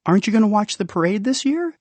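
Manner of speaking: put-on a touch of a high, quick child's voice; curious kid calling down the drain, lightly high and quick; lightly voiced child. Kid calling down to Rumpel, lightly high voice.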